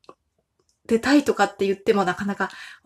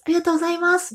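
campaign car announcer; 4 semitones higher than usual. Retold or imitated. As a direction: plead for votes like an uguisu-jo. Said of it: "みたいな感じでね". (選挙カーのウグイス嬢風の呼びかけ)

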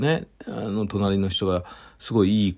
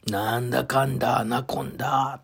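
しゃがれ声のエイジ; 6 semitones higher than usual. Retold or imitated. Host mimics a comedian's catchphrase for listeners, husky voice. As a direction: imitate a comedian's husky voice, pitched up and stretched. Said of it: "のしゃがれ声。".

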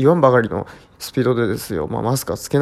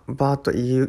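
usual speaking voice

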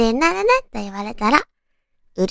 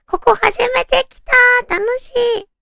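much higher, bubbly delight; excited girlfriend; 12 semitones higher, 5 dB louder than usual. Retold or imitated. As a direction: gush with excitement. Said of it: "なんて言われたら". (はしゃいだ高い声)

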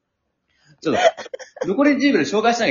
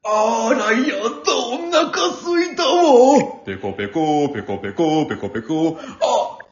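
campy lady voice. Acted. Campy puppet skit.

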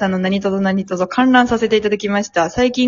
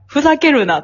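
raised, lightly mimicked telling-off; Kano impression; 6 semitones higher, 3 dB louder than usual. Reading out the radio script, mock scolding tone. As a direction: scold in a borrowed Osaka manner. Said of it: "加納さんの口調になってる".

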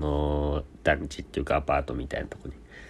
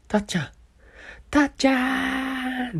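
much higher, drawn-out cry of the name; anguished call. Retold or imitated. Crying out to the friend in the hallway, a slow anguished cry. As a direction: wail the name like a tragic film.

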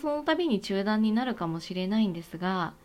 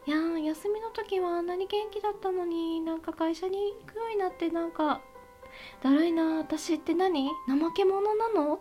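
worried self-doubt; 6 semitones higher than usual. Retold or imitated. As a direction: fret to yourself, lost and tired. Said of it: "とかっていうふうに思われている方".

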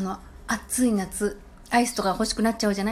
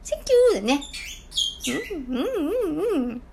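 excited thank-you; 8 semitones higher than usual. Real, not acted. Joyful thanks to listeners.

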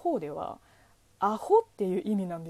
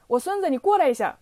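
loud, higher-pitched mock summons; mock calling out; 6 semitones higher, 8 dB louder than usual. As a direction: call a coworker over loudly in Chinese. Mock Chinese colleague calling out.

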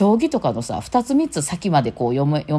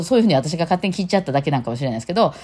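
normal talking voice

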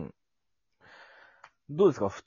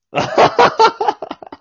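sudden loud burst of laughter; loud high laugh; 13 dB louder than usual. Real, not acted. Bursts out laughing about hangover.

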